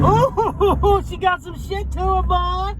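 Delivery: high, delighted whoop with a laugh; thrilled whoop. Real, not acted. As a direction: whoop at the car's power. Car pulls hard, delighted.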